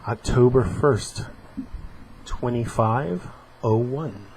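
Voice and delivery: comic panels read aloud with voices; acting out a comic strip; dramatic reading.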